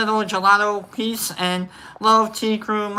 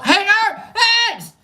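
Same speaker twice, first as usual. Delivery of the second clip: high, raised-voice call; 12 semitones higher, 3 dB louder, much slower than usual. Real, not acted. Host hails a viewer he forgot to greet, high calling out. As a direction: holler the name up high.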